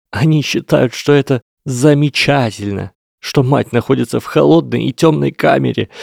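high, loud and tearful; anguished outburst. A narrator's reading. Lesath cries out about his parents in Azkaban, anguished and loud. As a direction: pour out years of grief at once.

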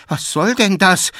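high, loud startled protest; alarmed and confused. A narrator's reading. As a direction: cry out in bewildered fright. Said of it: "verwirrt und erschrocken". (erschrocken aufrufen)

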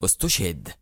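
low, softened, restrained grief; a touch of sorrow under resolve; firm with sorrow. A narrator's reading. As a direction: break the news firmly, with quiet regret. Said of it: "في حزم يحمل رنة أسف وأسى".

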